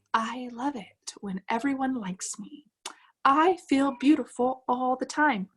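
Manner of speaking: slow, measured affirmation read-out; affirmation recording voice. Retold or imitated. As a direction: recite it slowly like a self-help tape. Voicing a recorded affirmation track.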